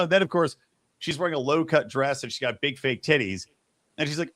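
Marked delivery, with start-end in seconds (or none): none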